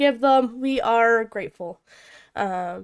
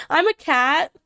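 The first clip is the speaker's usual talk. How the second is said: ordinary talk, nothing marked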